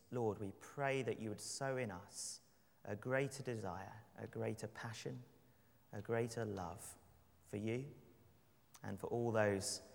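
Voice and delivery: soft, low prayer voice, unhurried. Acted. Opening prayer before the sermon.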